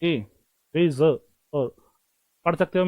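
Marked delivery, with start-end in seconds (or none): 0.8-1.2 रेजर drawn out slowly with a z sound; slow demo of रेजर. Shown as a demonstration. Teacher slowing down रेजर so it isn't read as रेसर. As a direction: stretch रेजर out syllable by syllable. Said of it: "रेजर को रेसर".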